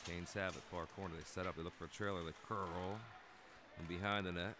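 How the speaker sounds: brisk running hockey commentary; live play-by-play. Acted. Commentator calling the game for viewers, brisk play-by-play. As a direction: call the action as it happens.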